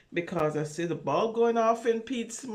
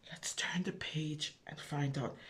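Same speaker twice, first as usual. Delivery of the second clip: unvoiced hushed whisper, much softer; 6 semitones lower, 11 dB quieter than usual. Reader to children, whispering before the page turn. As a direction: breathe it like a secret to the children.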